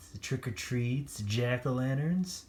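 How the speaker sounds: ordinary delivery, nothing marked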